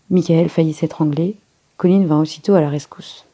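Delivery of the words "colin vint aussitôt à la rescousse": plain reading voice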